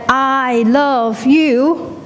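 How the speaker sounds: high, very drawn-out calling out; shouted call. Acted. Someone on a cliff shouting love out, long high call. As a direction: stretch each word out across the valley. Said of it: "if you shout back".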